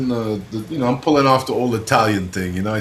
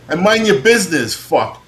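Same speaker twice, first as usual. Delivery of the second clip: retold telling-off, suddenly much louder; angry outburst; 6 semitones higher, 9 dB louder than usual. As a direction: burst out indignantly at the busybody. Acted outburst at a meddling passerby.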